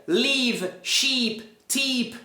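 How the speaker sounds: "leave, sheep, tip" all with long EE; wrong long vowel. Shown as a demonstration. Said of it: "não tá pronunciando corretamente".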